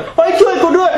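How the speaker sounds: high, squeaky cry for help, laughing through it; mock cry for help. Retelling his childhood near-drowning to the hosts, a high, laughing mock cry for help. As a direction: squeal it like a panicking kid stuck upside down, half laughing.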